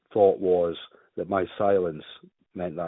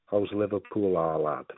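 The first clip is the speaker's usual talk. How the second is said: put-on a light try at a Scouse accent, teasing; half-hearted Liverpool accent, playful jab. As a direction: put on a bit of Scouse for a joke. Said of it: "That was good".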